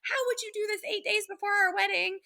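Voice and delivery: high-pitched mock outraged yelling; imitating angry fiancée. Fiancée confronting the other woman, high, imitated outrage. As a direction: play the betrayed fiancée, voice up high and shrill with outrage. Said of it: "And she's like".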